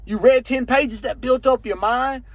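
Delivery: ordinary delivery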